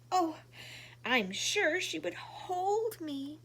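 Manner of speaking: sobbing. Acted. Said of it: "sobbed Chaco".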